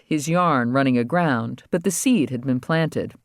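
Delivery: usual speaking voice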